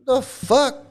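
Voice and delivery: high rising disbelief; incredulous. Real, not acted. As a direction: squeak it out in disbelief. Incredulous outburst.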